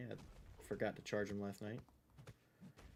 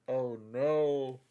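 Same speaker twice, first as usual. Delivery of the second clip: mock despair; 3 semitones higher, 10 dB louder than usual. Reacting to lost expensive necklace.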